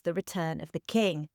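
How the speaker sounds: low, soft intoning of the episode title; dramatic title read. Acted. Host opens the episode by announcing the title low and hushed. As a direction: open like an epic, voice dropped low.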